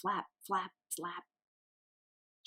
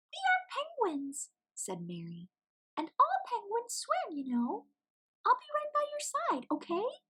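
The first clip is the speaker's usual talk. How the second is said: much higher, squeaky chick voice; squeaky little penguin. A narrator's reading. Mary's held voice.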